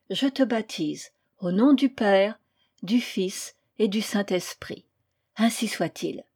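measured ritual formula with pauses; solemn baptism. A narrator's reading. Priest baptising the newborn in the train, solemn. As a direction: pronounce it gravely, phrase by phrase. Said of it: "en prononçant".

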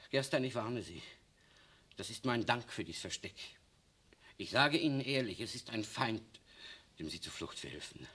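hushed bitterness after the rage; quiet bitter warning. Acted.

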